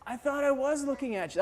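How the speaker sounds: soft, dazed imitation; lightly voiced ailing man; weak sick friend. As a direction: answer faintly, out of your mind with sickness.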